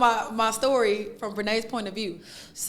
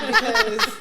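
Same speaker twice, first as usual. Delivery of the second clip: high squealing laugh; 10 semitones higher than usual. Real, not acted. Laughing about clips.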